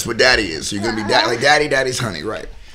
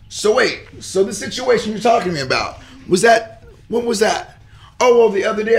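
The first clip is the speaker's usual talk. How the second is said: heated confrontation; 7 semitones higher than usual. Retold or imitated. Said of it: "You can see me just getting hot".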